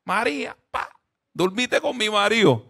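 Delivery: telenovela melodrama. Retold or imitated. Preacher parodies a wife confronting María over her husband, mock telenovela drama. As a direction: play the betrayed wife from a telenovela. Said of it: "Dramática. Súper dramática".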